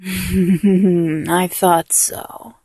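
soft breathy whisper, amused; teasing whisper. Acted. Teasing a bound partner in a low whisper. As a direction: whisper it like a private tease.

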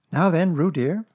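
high, loud, brisk mother voice; motherly Kanga. A narrator's reading. Kanga taking Piglet from her pocket, motherly.

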